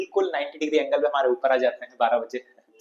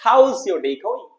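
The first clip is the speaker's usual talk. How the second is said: slow model reading; 5 semitones higher, much slower than usual. Shown as a demonstration. Teacher models the sentence.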